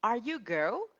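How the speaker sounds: quizzical mock of "Are you go?"; mock wrong question. Shown as a demonstration. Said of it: "What's that?".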